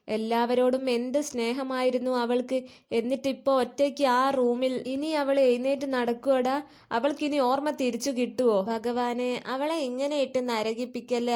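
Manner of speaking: ordinary delivery, nothing marked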